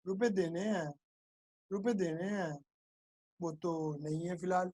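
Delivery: hushed, halting admission; acted reluctant mumble, very soft; sheepish debtor. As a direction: say it small and low, with pauses. Debtor sheepishly admits having no money.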